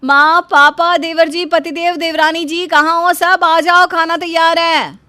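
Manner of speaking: high, loud call to the whole house. Acted. Daughter-in-law calling the family to eat, loud and high. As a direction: call everyone to the table.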